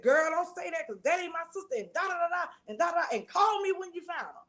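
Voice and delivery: snapping back at the niece in disbelief; retold anger. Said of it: "I got instantly mad".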